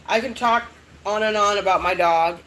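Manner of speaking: ordinary talk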